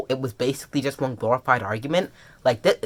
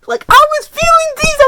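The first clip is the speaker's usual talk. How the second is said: high, loud squeal; high-pitched emotional outburst; overwhelmed gushing; 8 dB louder than usual. Real, not acted. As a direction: cry it out, swept up in feeling. Gushing over the emotions felt.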